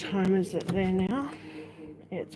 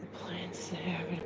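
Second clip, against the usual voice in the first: whisper; 10 dB quieter than usual. Real, not acted. Whispering during storm.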